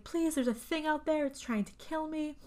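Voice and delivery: high-pitched imitation of panic; lightly acted frantic plea; mock freaking out. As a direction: plead like a frightened movie heroine. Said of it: "she's, like, freaking out".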